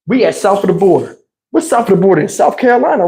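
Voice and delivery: ordinary delivery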